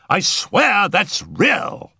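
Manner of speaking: very high, drawn-out exclamation; agitated cry. A narrator's reading. Settle starting up at the yowl, high agitated cry. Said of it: "he cried".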